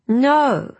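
sharp high cry of refusal; cried out. Acted. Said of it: "cried the queen".

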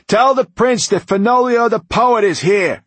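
loud, high announcement to the guards. A narrator's reading. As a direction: announce yourself loudly to the gate. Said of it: "he said, raising his voice".

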